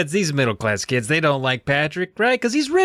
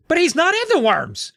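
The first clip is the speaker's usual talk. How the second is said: put-on high kid protest. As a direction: protest like a jealous boy. Todd protests in story.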